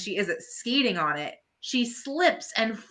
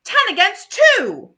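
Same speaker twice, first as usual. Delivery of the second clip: much higher, loud chime-in; eager Marcy; 13 semitones higher, 9 dB louder than usual. Acted. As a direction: insist on being counted. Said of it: "Marcy adds".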